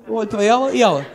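ordinary talk, nothing marked

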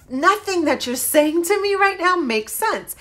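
retold exasperated pushback. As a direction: spell out, fed up, that none of it adds up. Retelling her heated phone argument.

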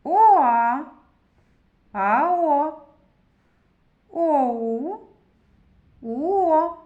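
Оа, А О, О У, У О as long gliding vowels, loud; blending letters. Shown as a demonstration. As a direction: glide loudly from one held vowel into the next. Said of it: "А тепер поєднаємо букви".

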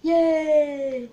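loud, high cheer; cheering the bad news. Real, not acted. Cheering Logan Paul's downfall.

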